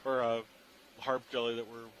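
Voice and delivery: ordinary talk, nothing marked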